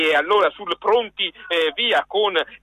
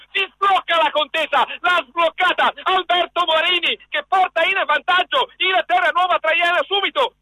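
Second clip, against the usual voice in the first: fast excited radio play-by-play; breathless italian goal commentary; 5 semitones higher than usual. Real, not acted. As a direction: rattle off the goal news.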